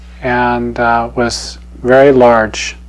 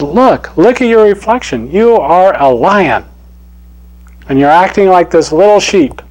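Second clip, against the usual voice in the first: urging lion; 6 semitones higher, 5 dB louder than usual. Acted. Older lion showing the cub his reflection, insistently. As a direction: make him see himself.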